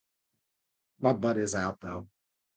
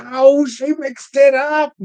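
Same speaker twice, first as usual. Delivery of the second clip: mocking voice; 11 semitones higher, 8 dB louder, slower than usual. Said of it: "'Cause they're like".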